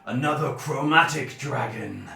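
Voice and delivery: slow, loud, imperious dragon voice; stern silver dragon. Acted. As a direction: challenge them slowly like a wary dragon.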